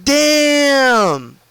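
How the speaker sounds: mock the shock of seeing them run; retelling a coworker's astonished outburst; freaked-out disbelief. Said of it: "And he freaked out".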